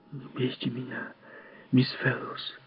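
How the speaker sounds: put-on a scared child's whisper; frightened whisper. The boy begs Miss Fellowes not to beat him, whispering. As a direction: breathe it out in fear, barely voiced. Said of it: "прошептал он".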